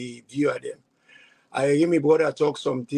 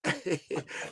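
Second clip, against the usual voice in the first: short laugh; 11 dB quieter than usual. Real, not acted. Laughs at own joke.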